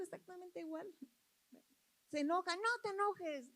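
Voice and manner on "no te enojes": mock annoyed retort, pitched up; angry retort. Answering someone who gets angry, mock angry retort. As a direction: scold back in a higher voice. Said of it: "y ya estamos más enojados".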